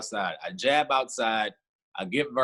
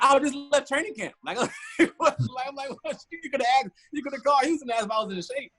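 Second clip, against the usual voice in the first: much higher, half-laughing disbelief; incredulous retelling. Retold or imitated. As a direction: tell it like you can't believe they asked. Retold indignation at the tryout.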